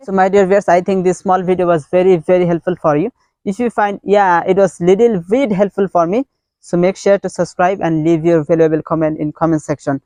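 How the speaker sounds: cheerful channel outro; vlog sign-off. Acted. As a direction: wrap up for the subscribers. YouTube sign-off.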